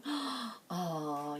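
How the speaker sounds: awed little gasp; gasp of wonder. Retold or imitated. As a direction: marvel at a beautiful sunset. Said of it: "it'll be like".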